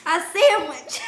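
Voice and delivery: loud, laughing retort; laughing shout. Real, not acted. Laughing retort about sandwich.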